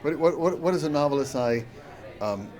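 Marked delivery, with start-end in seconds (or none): none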